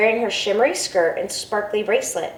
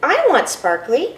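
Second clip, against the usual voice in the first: put-on eager child; 6 semitones higher than usual. Child demanding bracelet.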